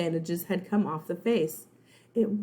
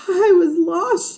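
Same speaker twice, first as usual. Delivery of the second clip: high, loud, slow, tearful cry; 11 semitones higher, 11 dB louder, slower than usual. Acted. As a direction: sob it out in relief. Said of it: "she cried".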